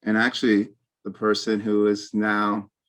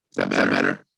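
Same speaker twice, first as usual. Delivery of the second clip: distorted robotic call audio; garbled digital voice; faster than usual. Real, not acted. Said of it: "you just started doing it. It's weird".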